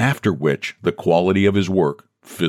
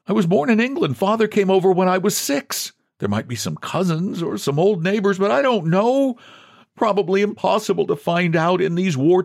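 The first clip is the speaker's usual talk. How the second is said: higher, lightly voiced John, anxious; mild pleading; 11 semitones higher than usual. A narrator's reading. John to the president, mild worried pleading. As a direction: reach for any proof.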